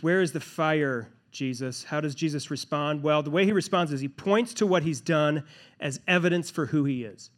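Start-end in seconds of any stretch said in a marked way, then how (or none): none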